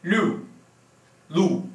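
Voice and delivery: lu einmal mit u, einmal mit ü; u versus ü. Shown as a demonstration. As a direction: lu zweimal kontrastiert. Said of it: "I'll pronounce it again".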